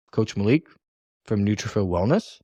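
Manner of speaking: "Coach Malik from Nutrafit Wellness" rising like a question; questioning intonation. Shown as a demonstration. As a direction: lift the end of "Wellness" into a question. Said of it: "it's more of a question".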